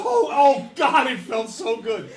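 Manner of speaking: loud, high cry of satisfaction; gleeful relish. Retold or imitated. Brother recalls finally hitting his sister, loud gleeful relish. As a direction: exult in it, drawn out and loud.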